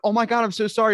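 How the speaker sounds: high, loud flustered apology; mock frantic apology; apologetic woman.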